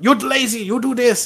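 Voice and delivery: a hint of a stern Korean supervisor; strict supervisor. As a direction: nag like a strict boss. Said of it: "he always told me".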